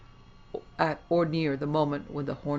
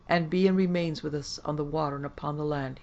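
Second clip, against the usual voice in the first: ordinary delivery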